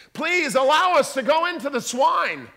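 high, loud begging demon; pleading demon. Acted. Demons begging Jesus for the swine, high and desperate.

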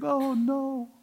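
high-pitched drawn wail of the doomed; quivering dread. Acted. Preacher voices scoffers facing crushing judgment, high quivering cry. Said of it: "they're quivering and saying".

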